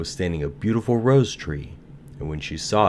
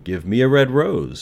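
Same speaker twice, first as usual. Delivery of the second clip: louder, higher pleading call; cried; 7 semitones higher, 7 dB louder than usual. A narrator's reading. Nightingale asks the third tree, crying out. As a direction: raise the voice and call out. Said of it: "she cried".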